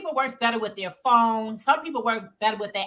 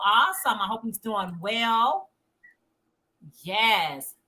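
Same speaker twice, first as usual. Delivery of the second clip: ordinary talk, nothing marked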